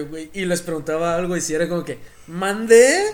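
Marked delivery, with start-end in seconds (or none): 2.3-3.0 high, loud mock chilango ¿Mandé?; sing-song chilango ¿Mandé? said loud; chilango accent. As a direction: imitate the Mexico City reply ¿Mandé?. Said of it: "gente del D F".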